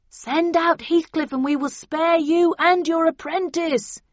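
high, slow shouted ultimatum; loud, measured threat from outside; bullhorn yell. A narrator's reading. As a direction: yell demands as through a bullhorn. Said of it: "yelled the bullhorn again".

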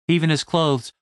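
plain reading voice

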